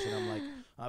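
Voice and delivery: ordinary talk, nothing marked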